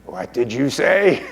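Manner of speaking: slow, put-on creature voice; Gollum. Gollum challenging Smeagol's defiance, slow creature voice. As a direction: stretch it out as an affronted creature. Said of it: "And then Gollum says".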